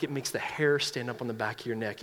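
ordinary talk, nothing marked

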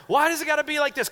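high, fast complaint; mock whining protest. Kid questioning a fed-up parent, high mock whine.

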